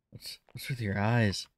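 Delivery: ordinary talk, nothing marked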